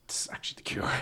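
hushed, breathy whisper of revelation; a reverent little whisper, as if you've found the answer; whispered aside. Retold or imitated. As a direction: whisper it like a secret discovery. Mock revelation while eating.

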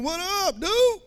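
playful hype-man hello; street greeting. Retold or imitated. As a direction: greet like a cocky guy pumped by the music.

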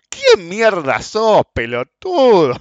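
slow, high, loud mock insult; angry yell. Quoting the man insulting him at the counter, loud drawn-out fury. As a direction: drag out the insult like a bully. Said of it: "me dice".

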